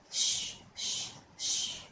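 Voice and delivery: whispered shh like wind; wind shushing. Shown as a demonstration. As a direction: blow a soft wind sound. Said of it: "say what I say".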